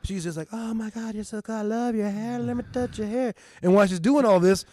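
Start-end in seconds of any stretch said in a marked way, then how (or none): 0.5-3.3 put-on high, gushing girly voice; mock starry-eyed gushing, pitched way up.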